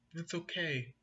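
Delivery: soft forgiving reassurance; gentle. Acted. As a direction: say it softly to make peace. Dex forgives the skunk gently.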